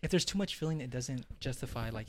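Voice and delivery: hushed, quiet voice; whispering. Real, not acted. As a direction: drop to a near whisper. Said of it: "why are you whispering?".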